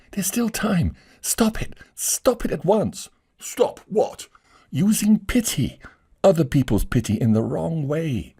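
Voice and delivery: high, pressing command; urgent lady. Acted. Lady urges him to stop.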